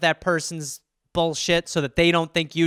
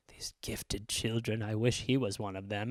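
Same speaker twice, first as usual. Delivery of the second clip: wistful mom; 9 semitones lower, 14 dB quieter than usual. Retold or imitated. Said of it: "she'd be, like".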